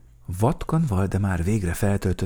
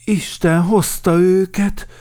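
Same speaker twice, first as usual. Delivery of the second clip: loud, raised happy greeting; delighted bear chief; joyful welcome; 10 semitones higher, 6 dB louder than usual. A narrator's reading. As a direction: welcome them gladly, voice lifted. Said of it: "mondta örömmel Medve Medárd".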